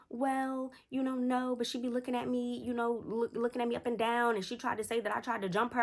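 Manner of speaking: high-pitched hedging voice; whiny excuse-making. Retold or imitated. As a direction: imitate a kid making feeble excuses. Voices girl's weak excuses.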